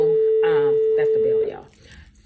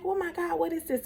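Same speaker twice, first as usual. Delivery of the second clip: high-pitched retold disbelief; shocked; 11 semitones higher than usual. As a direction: exclaim in high, stunned dismay. Shocked by runaway child.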